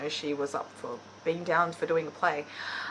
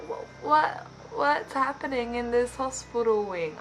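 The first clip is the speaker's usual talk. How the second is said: higher, louder mock disbelief; bewildered; 5 semitones higher, 3 dB louder than usual. Vlogger baffled by the hospital wing scene, bewildered. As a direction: act out a baffled reader's reaction. Reader reacting to the book's scene.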